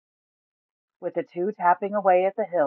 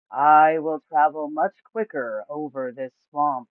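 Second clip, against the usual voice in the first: slow Gar; 6 dB louder, slower than usual. A narrator's reading. Giant turtle Gar waking up, speaking slowly. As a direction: drag each word like a sleepy giant.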